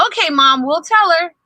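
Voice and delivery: put-on happy boys on a call; cheerful kid reply. A narrator's reading. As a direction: answer mom brightly. Kids answer mom.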